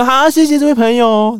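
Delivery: high, loud, polite dismissal; brushing off the fan. Retold or imitated. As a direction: hurry the fan off stage. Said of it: "反正就是说".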